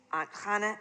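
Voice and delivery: ordinary delivery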